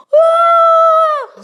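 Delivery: a high, loud shouted wah; sudden loud wah for the mourning crowd; loud exclamation. Acted. Depicting noisy mourning crowd.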